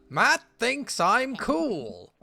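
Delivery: high, loud, slow proud dad. Acted. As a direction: gush it like a thrilled dad. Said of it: "It's my dad voice".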